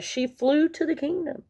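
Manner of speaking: usual speaking voice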